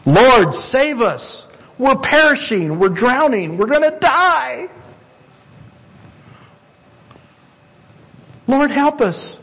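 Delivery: high, pleading cries of drowning men; desperate cry for help. Retold or imitated. Disciples in the storm crying out to Jesus, high and desperate. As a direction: cry out to the Lord as if the boat is sinking. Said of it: "they cried out".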